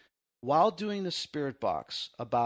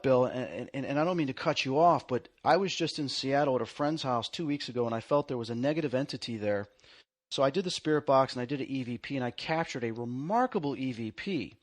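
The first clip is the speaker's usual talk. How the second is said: ordinary talk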